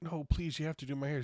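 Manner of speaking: high, begging plea; pleading Sharon. Retold or imitated. Customer begging the owner to do her hair, high pleading voice. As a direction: beg desperately in a high voice. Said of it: "went from apologetic".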